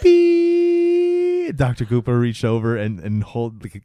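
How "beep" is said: high flat held beep; monitor beep. Retold or imitated. Host imitating the monitor beep as the boy dies. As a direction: imitate a hospital heart monitor. Heart monitor after the death.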